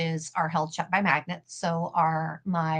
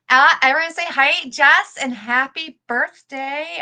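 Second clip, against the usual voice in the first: much higher and louder, slow sing-song greeting; hyped-up high, loud birthday greeting to Jess; said it weird; 7 semitones higher, 7 dB louder than usual. Real, not acted. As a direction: belt out a goofy birthday shout-out. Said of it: "I don't know why I said it weird".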